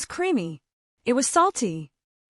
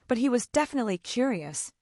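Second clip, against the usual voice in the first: ordinary delivery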